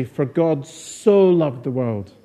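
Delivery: SO stretched long and punched in "God so loved"; stress on SO. Shown as a demonstration. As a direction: draw out the word so. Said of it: "putting the stress on different words".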